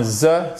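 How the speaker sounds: THE said as a buzzing zeu; isolated voiced z plus short vowel; Z sound. Shown as a demonstration. Teacher lists three endings for students, demonstrative z sound. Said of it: "la prononcer de trois manières différentes".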